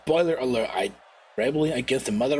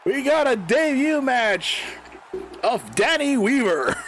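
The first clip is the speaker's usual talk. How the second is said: announcer line; 6 semitones higher than usual. Retold or imitated. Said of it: "I was supposed to say".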